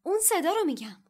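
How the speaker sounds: loud, high and impatient. A narrator's reading. Harry insisting to Lockhart, loud and impatient. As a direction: cut him off, impatient and insistent. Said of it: "هری با بیتابی گفت".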